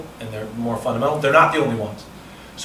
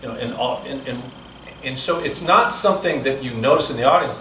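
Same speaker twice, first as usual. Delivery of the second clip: ordinary delivery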